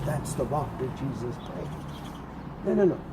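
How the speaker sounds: normal talking voice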